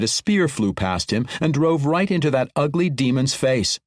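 ordinary delivery

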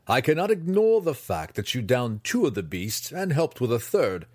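scolding. Acted. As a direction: dress him down hard. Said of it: "Hatchnet scolded".

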